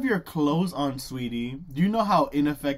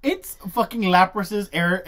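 ordinary talk

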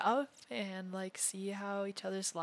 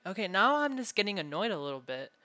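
louder, mildly annoyed; raised annoyed voice; 8 dB louder than usual. Acted. Captor losing patience over anime, raised voice. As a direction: let annoyance raise your voice. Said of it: "I raised my voice a little bit".

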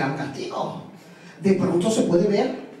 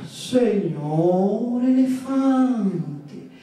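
voz aguda y lenta de tortuga; tortuga sabia; 6 semitones higher, much slower than usual. A narrator's reading. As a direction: aconseja despacio y con calma. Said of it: "y le dijo". (doña Tortuga aconseja al elefante, voz aguda y pausada)

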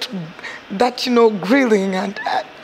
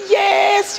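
high cheering shout; crowd cheer; 10 semitones higher than usual. Retold or imitated. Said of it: "people would scream for them".

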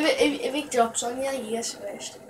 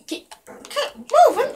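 mock game voice; 11 semitones higher, 5 dB louder than usual. Mocking game voice line.

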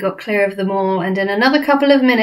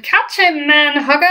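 high, louder mock giant shout; giant's shout; 7 semitones higher, 4 dB louder than usual. Flesh lump eater shouting to the man hugger, high mock shout. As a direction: fling the call across the field.

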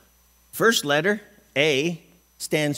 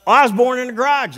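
mock excuse; 10 semitones higher, 7 dB louder than usual. Mocking joke excuse.

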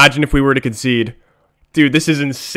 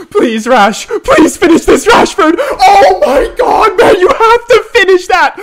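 higher, louder, rising to a shout; desperate pleading; 5 dB louder than usual. Real, not acted. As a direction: beg the striker to score. Begging striker to score late.

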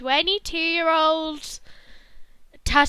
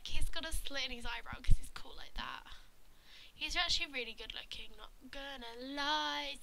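whispered aside; 10 dB quieter, faster than usual. Pretend. Whispered aside about contestant.

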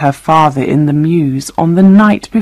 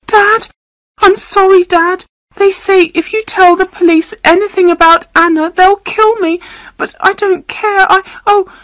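put-on very high, frightened girl on the phone; terrified child's high-pitched pleading. A narrator's reading. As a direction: plead to dad in a high, scared squeal. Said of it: "Alan heard the high, frightened voice of his daughter".

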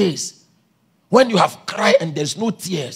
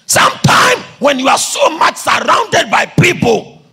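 high preacher's shout; full-force preaching; 9 semitones higher, 6 dB louder than usual. Real, not acted. Preacher warning those surrounded by people, full-force preaching. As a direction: hammer the warning into the congregation.

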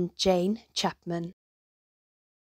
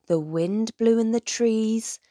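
ordinary delivery

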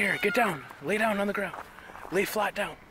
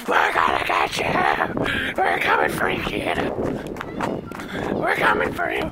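monster voice. Pretend. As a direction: growl it low like a monster coming for the kids. Monster voice chasing the kids.

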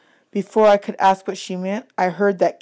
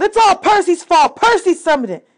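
high, loud angry accusing yell; yelled accusation; 11 semitones higher, 6 dB louder than usual. A narrator's reading. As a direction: blame him at the top of your voice. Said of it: "Clarice yelled".

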